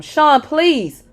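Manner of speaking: high, loud desperate plea; pleading. Acted. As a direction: beg him to stop. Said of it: "I pleaded with him".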